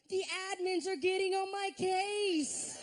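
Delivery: high, agitated mock complaint; worked-up, high-pitched protest; angry complaint. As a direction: imitate a friend panicking and annoyed. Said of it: "angry I ams from Harris".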